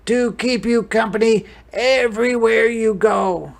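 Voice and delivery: high, loud, sing-song reassurance; animated storybook grandpa; encouraging grandfather. Acted. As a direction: lilt it up big for a child. Abuelo gives the keepsake ball.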